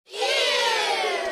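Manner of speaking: very high squealed ew; shrill-high cry of disgust; disgusted kids. A narrator's reading. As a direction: kids recoiling from a stink. Kids react to smell.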